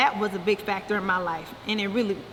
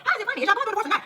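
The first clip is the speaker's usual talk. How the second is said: incredulous; 9 semitones higher, much faster than usual. Real, not acted. Indignant reaction to Drew's excuse.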